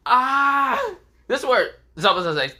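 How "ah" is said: high, loud "ah" of agony; mock pain scream. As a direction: cry out in hammed-up pain.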